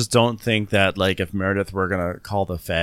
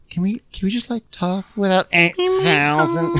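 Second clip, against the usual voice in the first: put-on high, whiny little-girl plea; 9 semitones higher than usual. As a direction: plead like a child with a blankie. Jen voiced in recap.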